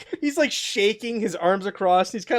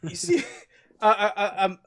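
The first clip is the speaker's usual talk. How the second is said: ordinary talk, nothing marked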